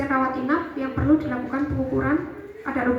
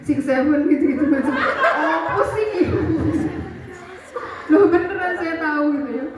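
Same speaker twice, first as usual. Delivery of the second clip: higher, dragged-out Apa sih through laughs; laughing; 4 semitones higher than usual. Real, not acted. Lecturer mocking Gen Alpha slang, laughing.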